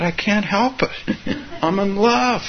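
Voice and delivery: high, helplessly happy outburst; acted-out euphoric confession, pitched high; retold giddy bliss. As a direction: imitate someone swept away by joy. Retold woman helplessly in love.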